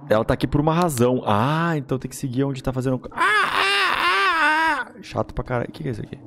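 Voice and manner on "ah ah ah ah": frightened scream. Real, not acted. Sudden fright while playing.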